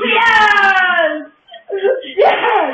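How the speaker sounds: high, loud cheering; exultant shout. Real, not acted. Player cheering mid-match, loud high shouts. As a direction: shout it out in triumph.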